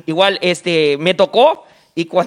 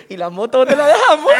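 remate del chiste; 6 semitones higher, 3 dB louder than usual. Retold or imitated. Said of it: "le dijo".

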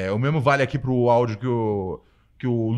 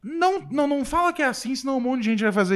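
alarmed objection; 10 semitones higher, faster than usual. Real, not acted. Host hurriedly objects to co-host's summary.

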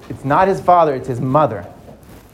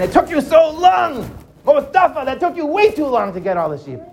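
father yelling; 8 semitones higher than usual. Acted. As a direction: snap at him for taking too long. Father yelling at late son.

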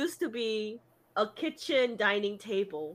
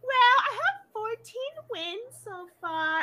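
put-on high-pitched kid voice; imitated little kid; 11 semitones higher than usual. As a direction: mimic a child reporting a score. Voices a kid reporting wins.

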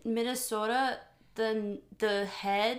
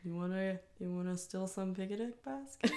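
softer cartoon bear impression; Yogi Bear; 7 dB quieter than usual. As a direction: coax your sidekick like a cartoon bear, quietly. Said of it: "You know, that Yogi Bear might".

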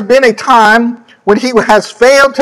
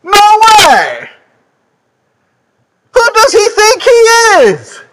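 higher and higher, indignant disbelief; incredulous townsfolk; 6 semitones higher than usual. Retold or imitated. Preacher imitating doubters who knew Jesus as carpenter, scoffing. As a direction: voice the neighbours who can't believe it. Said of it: "They might be thinking".

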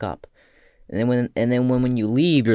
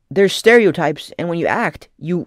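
ordinary talk

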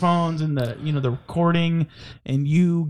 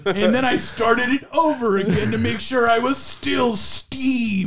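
higher, indignant mimicry; mock aggrieved reviewer; 4 semitones higher than usual. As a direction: play the outraged customer in a raised pitch. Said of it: "He's like, gives our podcast".

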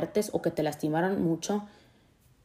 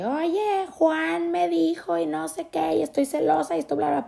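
put-on gossipy girlfriend; 10 semitones higher than usual. A woman venting to her girlfriend about Juan, high and gossipy. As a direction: spill the drama to your best friend. Imitating women chatting to a friend.

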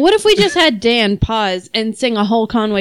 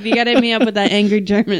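ordinary talk, nothing marked

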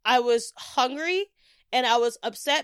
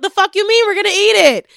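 loud and higher, mock outraged; incredulous yell; 10 semitones higher, 11 dB louder than usual. Telling Stephanie to keep the free food, incredulous yell. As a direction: bark it like it's obvious. Friend asks about extra platters.

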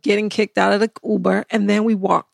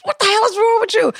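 mock angry yell; 11 semitones higher than usual.

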